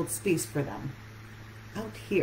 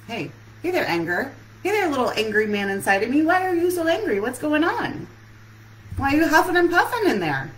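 higher, louder, mock-concerned questioning; coaxing her inner anger; 8 semitones higher, 5 dB louder than usual. Coach questions her own inner anger, higher and louder. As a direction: coax the little angry man like a fussing parent.

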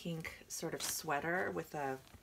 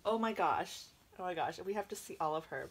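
ordinary delivery

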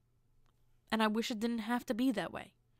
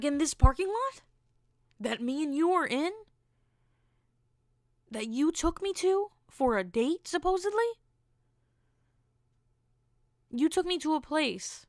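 higher-pitched, incredulous questioning; 6 semitones higher, 3 dB louder than usual. Retold or imitated. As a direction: question it in rising disbelief. Incredulous reply to date's coyote remark.

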